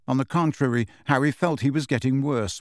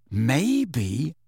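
slow. A narrator's reading. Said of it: "said Ron slowly".

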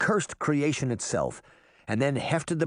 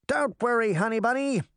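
put-on high, cocky boy's voice; sugary boast in a raised pitch; mock-gallant reassurance; 9 semitones higher than usual. A narrator's reading. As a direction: play the boastful little protector. Puck mock-gallantly reassures his sweetheart.

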